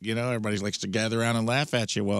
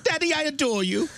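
put-on very high, snooty spoiled rich kid; entitled rich kid. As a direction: whine like a pampered 1800s heir. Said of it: "sound like a rich kid in a hot air balloon".

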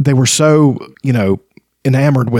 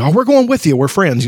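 eager friends; 6 semitones higher than usual. Retold or imitated. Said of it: "It's like".

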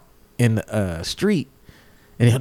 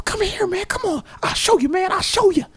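high-pitched insistent coaxing; squeaky-high mock urgency; imitated riffing. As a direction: imitate a frantic man urging someone to follow. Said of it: "while he's sitting there riffing".

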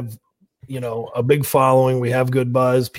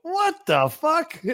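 high, slow, drawn-out outburst; hearing the bad news, stunned and slow; stunned disbelief; much slower than usual. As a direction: stretch it out high in shock. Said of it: "And I went".

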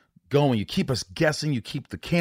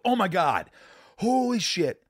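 mock amazement; 6 semitones higher, slower than usual. Imagined amazed horror fan reaction.

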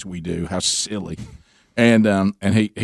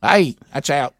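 robot umpire voice; 5 semitones higher, slower than usual. Retold or imitated. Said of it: "I wish Gary was that voice".

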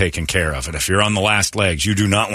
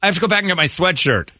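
mocking friend; 9 semitones higher than usual. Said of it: "your friend going".

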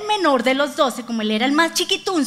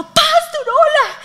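put-on high little girl's greeting; 13 semitones higher, 6 dB louder, slower than usual. Little girl greeting the pastor, high voice. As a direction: pipe it up like a small girl. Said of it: "Una niña se me acercó y me dijo".

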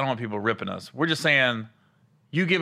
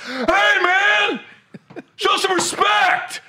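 scolding shout; 4 dB louder, much slower than usual. Retold or imitated. Old man scolds during anthem.

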